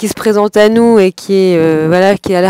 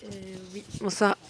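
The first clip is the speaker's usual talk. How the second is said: phone held away; 3 semitones lower, 22 dB quieter, faster than usual. Real, not acted. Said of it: "Sinon, on t'entend pas".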